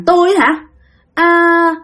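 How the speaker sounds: high-pitched spirit voice; possessed woman. Retold or imitated. Possessed woman answers the brave man, high-pitched.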